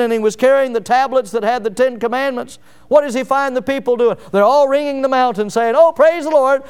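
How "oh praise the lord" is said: higher, louder mock praise; mock rejoicing. Imagined Israelites greet Moses' return, mock rejoicing. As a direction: cry it out like a jubilant crowd.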